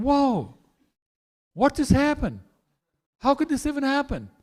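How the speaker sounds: high, bewildered mock disbelief; stunned Krishna. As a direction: act shocked at being kicked out. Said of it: "He's thinking".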